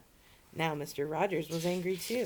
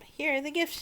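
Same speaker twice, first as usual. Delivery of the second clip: excited; 11 semitones higher, 6 dB louder than usual. A narrator's reading. Dumping gifts on the guest.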